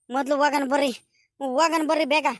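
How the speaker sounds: normal talking voice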